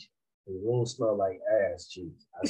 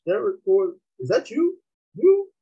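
mock disbelief, higher and louder on each question; incredulous teacher; 12 semitones higher, 6 dB louder than usual. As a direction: imitate a doubting teacher, pitch climbing. Teacher reads name in retold story.

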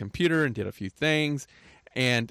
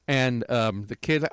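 ordinary delivery

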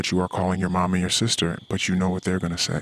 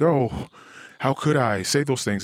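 mock remorse; 6 semitones higher than usual. Mocking his own imagined remorse.

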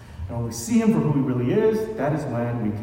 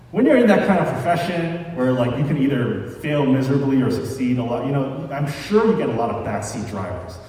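normal talking voice

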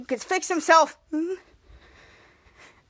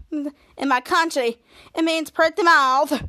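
slow foreign maid; slower than usual. Retold or imitated. Maid Schwaz explains her name.

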